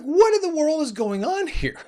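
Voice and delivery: exasperated disbelief. Retold or imitated. Caller baffled by the agent's runaround, high incredulous outburst. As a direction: throw up your hands in disbelief.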